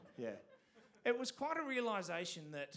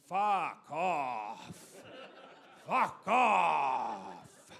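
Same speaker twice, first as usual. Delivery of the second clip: "Fuck off" spelt out slowly, each word pressed, building loud; over-enunciate "Fuck off" like a kid who has learned it, growing into a shout; enunciating; 2 semitones lower, 10 dB louder, much slower than usual. Retold or imitated. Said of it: "like, enunciating".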